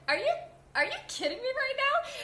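can't believe what you just heard; incredulous. Retold or imitated. New swing reacting to the choreographer, high incredulous disbelief. Her reaction to his rudeness.

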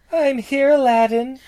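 high princess voice; Jasmine voice. Acted. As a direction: call sweetly as the princess. Voicing Jasmine.

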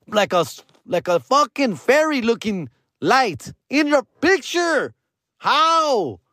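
high-pitched, emphatic amazement; incredulous. Real, not acted. Storyteller amazed by a sparkle in the picture, incredulous.

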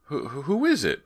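high, loud, slow stammered question; startled stutter, pitch shooting up; stammering. Acted. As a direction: stammer it out in alarm. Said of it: "Brant stammered".

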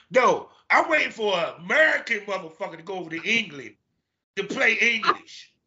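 high-pitched comic complaint; retold indignation. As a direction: protest it in mock exasperation. Retold indignant reply about casting.

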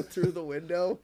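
ordinary delivery

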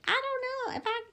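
high squeaky waver of doubt; a tiny high voice of a confused mind; squeaky unsure mind voice. Retold or imitated. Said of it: "your mind's like".

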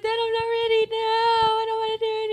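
high, whiny protest to dad; mock scared whine. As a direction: play the scared kid refusing to drive. Retelling panic at first drive.

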